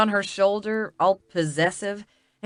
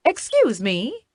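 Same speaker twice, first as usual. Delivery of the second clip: higher, mock-British call for attention; British accent; 5 semitones higher than usual. A narrator's reading. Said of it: "in my best British accent".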